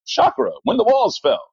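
high-pitched imitation of a TV alien. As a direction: declaim it as a cryptic alien would. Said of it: "But the alien was like".

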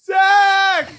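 high, loud, drawn-out call; retold yelling. Imitating muted John calling through others' speakers, yelling. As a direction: yell the name as a whiny mock plea. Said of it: "hear him through everyone else's speakers going".

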